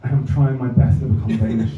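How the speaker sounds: ordinary talk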